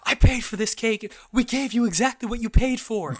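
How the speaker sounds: high, loud imitated arguing; hammed-up indignant yelling; retold yelling match. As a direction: act out both sides of a shouting match. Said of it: "we were yelling at each other".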